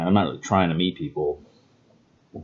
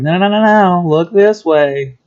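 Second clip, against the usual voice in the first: high, loud, drawn-out no's then slow look this way; insistent no; 11 semitones higher, 8 dB louder than usual. Retold or imitated. Answering the world's pull, high, loud and insistent. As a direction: pull them back, much higher and louder, slowing on look this way. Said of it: "And I'm like".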